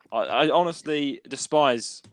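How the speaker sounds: ordinary delivery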